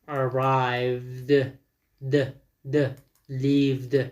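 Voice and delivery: "arrived" and "lived" ending in a voiced D; final D sound. Shown as a demonstration.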